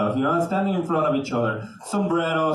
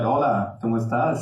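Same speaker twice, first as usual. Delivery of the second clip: lower, seductive Spanish greeting; smooth pickup line; 7 semitones lower than usual. Retold or imitated. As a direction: purr it to impress the ladies. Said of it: "I go like".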